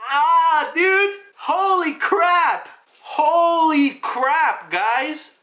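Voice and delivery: loud, high, slow exclamations; real fan blown away, drawn out and high; awestruck hype. Real, not acted. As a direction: stretch out every word in amazement. Reacting to end of chapter.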